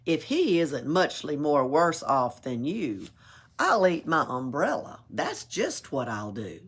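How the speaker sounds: plain reading voice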